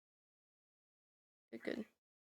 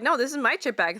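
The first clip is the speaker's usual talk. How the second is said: defiant shout; 5 semitones higher, 8 dB louder than usual. Retold or imitated. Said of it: "You'll be like".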